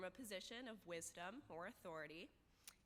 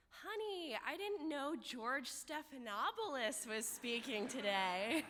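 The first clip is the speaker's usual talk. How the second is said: hammed-up parent, pitched high and louder; excited parent; 4 semitones higher, 7 dB louder, slower than usual. Graduate imitating an overheard parent at convocation, excited. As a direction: imitate a thrilled parent, stretching the last words. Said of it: "waving their program and saying".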